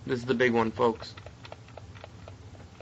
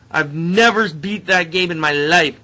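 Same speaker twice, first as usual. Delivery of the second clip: loud, slow, higher-pitched amazement; elated disbelief; 5 semitones higher, 7 dB louder than usual. Real, not acted. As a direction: marvel loudly at the win. Celebrating the long-awaited win.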